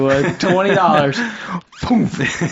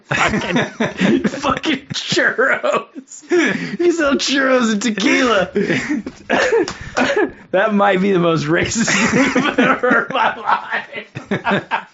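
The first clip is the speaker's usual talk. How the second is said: high pitched laughing through words; cracking up while talking; laughing hard; 6 semitones higher, much slower than usual. Real, not acted. Laughing hard at racist joke.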